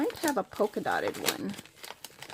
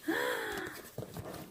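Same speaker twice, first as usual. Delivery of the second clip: gasp; 12 semitones higher, 9 dB quieter than usual. Real, not acted. Reacting to felt bats.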